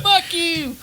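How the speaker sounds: high laughing mock retort; mock yell back. Friend mimics Gabby yelling back at Kelly, high laughing retort.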